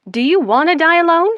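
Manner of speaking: high, loud, quick taunt; teasing jab. Acted. Friend goading her with a taunt.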